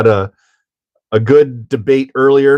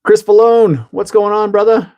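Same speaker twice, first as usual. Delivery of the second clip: excited greeting; 10 semitones higher than usual. Real, not acted. Greeting a viewer in chat.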